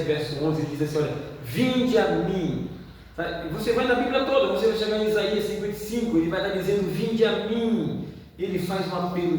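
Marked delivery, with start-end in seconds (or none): 1.4-2.7 drawn-out, solemn plea; slow, solemn preacher's call. Acted. Preacher quoting God's call. 6.9-8.1 drawn-out, solemn plea; slow, solemn preacher's call. Acted. Preacher quoting God's call.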